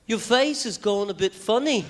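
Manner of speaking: put-on high, lilting British accent. Beatle greets nervous guest, lilting British accent. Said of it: "the first thing [name] said to me was".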